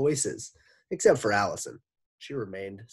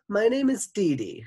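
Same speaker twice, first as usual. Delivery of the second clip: put-on much higher, louder little girl; small energetic voice; 12 semitones higher, 8 dB louder than usual. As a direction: bounce it like a super ball. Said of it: "Her voice was small but full of energy".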